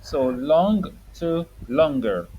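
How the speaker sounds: slow, drawn-out model words; slow teaching model. Shown as a demonstration. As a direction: spell it out slowly for a child. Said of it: "Say longer".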